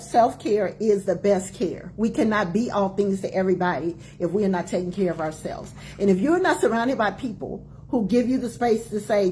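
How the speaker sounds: normal talking voice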